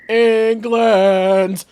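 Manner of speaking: mock chav yell. Imitating drunk football fans outside the pub, loud yell. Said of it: "hear all the chavs yelling".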